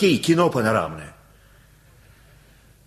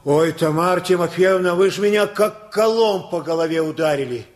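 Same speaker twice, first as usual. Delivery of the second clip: higher, rapturous outpouring; father beaming at the mother over their children, overjoyed; 7 semitones higher than usual. Acted. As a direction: gush with delight, almost singing it. Father delighted about the children.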